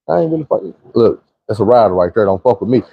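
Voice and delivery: usual speaking voice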